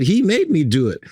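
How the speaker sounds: high, imitated self-defence; hammed-up tattling to get out of trouble; protesting blame. Tiler blaming the designer to the client, protesting.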